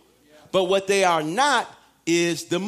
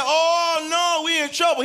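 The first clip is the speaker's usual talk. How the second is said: mock panicked devil; 6 semitones higher, 3 dB louder than usual. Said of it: "the devil starts saying".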